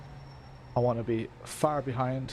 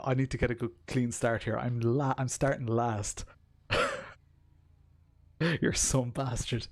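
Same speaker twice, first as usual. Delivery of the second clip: ordinary talk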